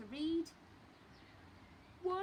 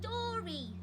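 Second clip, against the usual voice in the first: put-on higher, louder little baby demand; 11 semitones higher, 5 dB louder than usual. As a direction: insist like a small toddler. The baby asks again.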